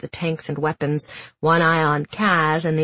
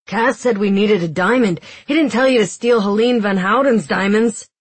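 higher, louder disbelief; astonished; 5 semitones higher, 5 dB louder than usual. Acted. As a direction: gasp at the theft. Jesper astonished at stolen choker.

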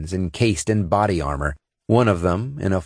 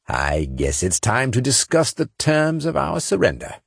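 forced confident cheer; 6 semitones higher than usual. A narrator's reading. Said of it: "forcing a confident and cheery tone".

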